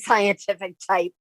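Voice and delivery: ordinary talk, nothing marked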